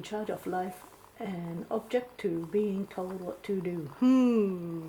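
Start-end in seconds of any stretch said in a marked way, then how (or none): 4.0-4.8 loud thoughtful hum. Real, not acted. Palm reader pondering aloud.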